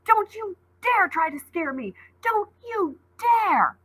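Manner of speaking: high, slow screaming in terror and rage; girl screaming at a monster who mocks her; terrified, furious scream. A narrator's reading. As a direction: scream it out, half fear, half fury. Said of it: "Ollie screamed at him, screamed with a mix of terror and rage".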